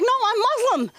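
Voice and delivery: high protesting correction; high insistent reply. Retold or imitated. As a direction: pitch it up, insisting. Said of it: "I'm like".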